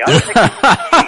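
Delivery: boomed mock English accent. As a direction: push the English tea joke harder. Repeats tea joke louder.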